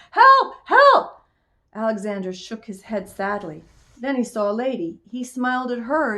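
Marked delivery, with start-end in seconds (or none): 0.1-1.2 high, very loud yell; alarmed, high-pitched shout; hollered cry for help. A narrator's reading. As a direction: holler for help at the top of your voice.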